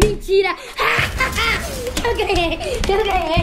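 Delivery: loud laughing victory shout; shrieking jackpot winner. Real, not acted. Son wins the game.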